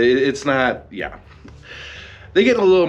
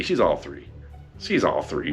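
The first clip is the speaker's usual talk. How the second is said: deadpan; 11 semitones lower, 7 dB quieter than usual. Real, not acted. Deadpan verdict on Shuri.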